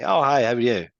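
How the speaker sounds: match the caller's energy; matching enthusiasm. Retold or imitated. Salesman matching enthusiastic prospect, upbeat greeting. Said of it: "and I'll be like".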